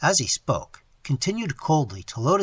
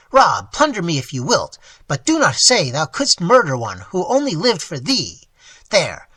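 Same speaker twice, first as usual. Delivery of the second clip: loud, desperate higher pleading; pleading exclamation; 3 semitones higher, 8 dB louder than usual. Acted. Old man begging his ward to take the gold, loud pleading. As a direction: beg him frantically to take the gold. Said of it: "with sudden energy, he exclaimed".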